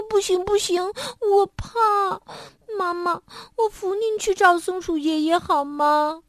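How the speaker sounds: high, tearful pleading; a frightened child begging not to go alone; scared little monkey. A narrator's reading. Little monkey begs his mother to come along, high and tearful.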